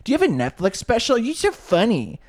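high, loud, breathless fangirl voice; mock gushing girl. Woman in the Uber gushing over the comedian, high mock gush. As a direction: gush like a starstruck fan, way up high. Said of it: "And she's like".

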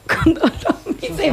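laughing through the words; giggling while talking. Real, not acted. Host laughing mid-sentence.

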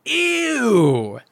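high-pitched, drawn-out mocking outcry; mock yelled disgust. A narrator's reading. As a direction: act out a schoolboy announcing his disgust. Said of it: "He yelled loudly".

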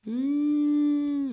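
long, high steady "ooh"; imitated eerie noise. Storyteller mimicking the noise from the escarpment, high drawn-out ooh. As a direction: hold a high ghostly hoot. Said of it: "the noise was, um, just a".

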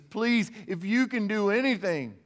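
high, pleading cry of a desperate father; begging. Pretend. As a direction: beg for mercy with everything. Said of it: "he just begs Jesus".

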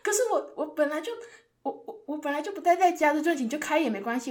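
dad protesting. Retold or imitated. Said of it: "然后我爸就说".

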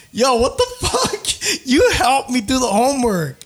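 laughing in mock outrage at the TA; outraged laughing. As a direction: squeal the complaint through laughter. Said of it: "He was like".

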